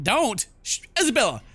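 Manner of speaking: urgent plea in full voice. Acted. Captive urging Isabella to stop, urgent and voiced.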